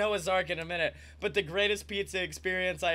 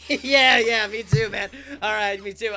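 breathless; 2 semitones higher, 9 dB louder than usual. Retold or imitated. Breathless delivery guy, continuing.

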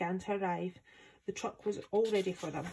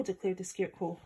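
usual speaking voice

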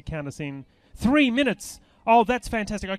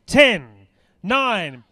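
countdown shout; 7 semitones higher, 7 dB louder, much slower than usual. Real, not acted. Final countdown to the song.

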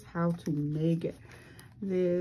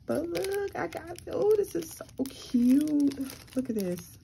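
excited squeal; 10 semitones higher than usual. Real, not acted. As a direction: squeal over how cute it is. Gushing over a handmade gift.

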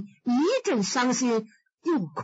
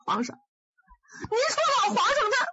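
high, wailing sobs, rushing at the end; fake wailing; 14 semitones higher than usual. Wei Xiaobao fake-wails to the emperor, high sobbing. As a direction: bawl on cue to win favour, much higher. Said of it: "说哭就哭".

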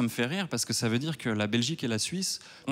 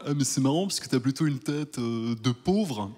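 French onlooker. Retold or imitated. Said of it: "ils me font souvent la remarque".

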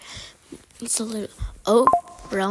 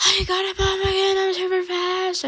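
high, fast, run-together squeal; excited squeal; 11 semitones higher, 5 dB louder than usual. Real, not acted. Player racing off with the bomb, excited squeal. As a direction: squeal it out high in one rush.

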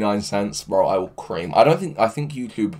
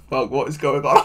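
usual speaking voice

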